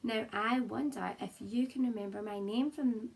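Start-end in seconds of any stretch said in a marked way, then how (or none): none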